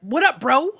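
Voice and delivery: a hint of a guy greeting his buddy; mocking women who greet her like a guy; imitating a bro greeting. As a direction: toss off a casual bro hello, lightly mocked. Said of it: "speak like that".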